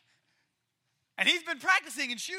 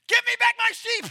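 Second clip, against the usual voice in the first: a much higher, much louder shout at a lion; furious yell; 11 semitones higher, 11 dB louder than usual. Acted. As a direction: yell it like you're chasing the lion down. Boy yells at lion.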